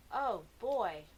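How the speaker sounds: ordinary delivery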